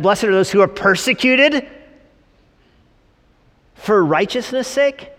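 high, loud, questioning echo; mock incredulity. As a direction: repeat the verse as if it were absurd. Incredulous repeat of the verse.

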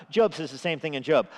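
normal talking voice

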